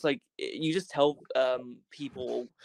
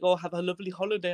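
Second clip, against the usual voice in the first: put-on quick, higher Indian-accented sign-off; 5 semitones higher, faster than usual. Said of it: "I call up the Indian lady".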